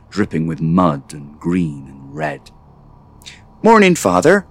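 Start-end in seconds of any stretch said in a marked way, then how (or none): 3.6-4.5 high, loud greeting from a son; cheery young son. A narrator's reading. Son greets father over breakfast, high cheery voice. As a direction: pipe up high, pleased to see father. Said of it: "smiled Oran".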